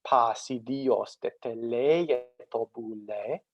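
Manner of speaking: slow, chant-like Greek verse with pitch accents; ancient Athenian recitation. Shown as a demonstration. Lecturer demonstrating Iliad's opening for students, measured ancient Greek recitation. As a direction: recite Homer slowly, drawing out long vowels and pitch glides. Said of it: "this is how a, an Athenian around the year four hundred".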